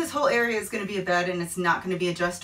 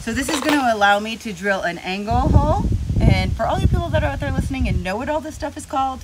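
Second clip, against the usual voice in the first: normal talking voice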